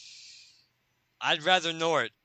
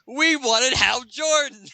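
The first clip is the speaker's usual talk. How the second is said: high, loud, slow whiny complaint; disappointed bankers; 12 semitones higher, 7 dB louder, slower than usual. Acted. Bankers complaining about getting Nort. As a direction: whine it like a let-down crowd. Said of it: "It's like".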